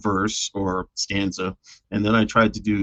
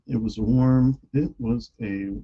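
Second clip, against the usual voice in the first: normal talking voice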